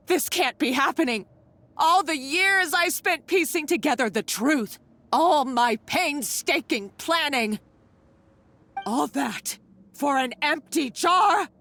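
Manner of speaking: her life's work wasted, building to an outcry; anguished outburst. Acted. Villain's despair builds.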